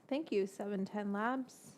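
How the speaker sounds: normal talking voice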